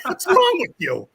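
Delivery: high, drawn-out mock outrage with a laugh; laughing scolding. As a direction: scold him slowly while laughing. Retold scolding amid laughter.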